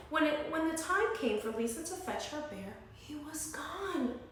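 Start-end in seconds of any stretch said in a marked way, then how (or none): none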